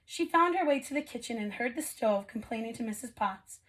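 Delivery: usual speaking voice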